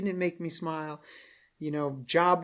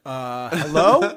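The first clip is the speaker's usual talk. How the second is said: hesitant call out; 10 dB louder, much slower than usual. Retold or imitated. Someone climbing in window.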